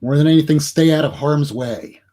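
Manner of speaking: ordinary talk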